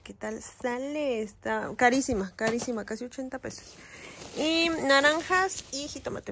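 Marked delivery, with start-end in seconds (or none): none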